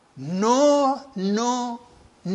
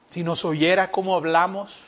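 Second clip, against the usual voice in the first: ordinary delivery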